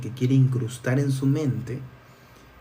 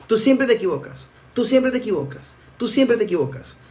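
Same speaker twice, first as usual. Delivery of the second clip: higher-pitched mock reproach; nagging partner; 7 semitones higher than usual. Partner scolding you again and again, higher mock reproach. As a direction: imitate a partner's repeated complaint.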